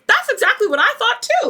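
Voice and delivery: high, excited agreement; emphatic agreement. Retold or imitated. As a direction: jump in high and eager, totally agreeing. Acts out eager agreement.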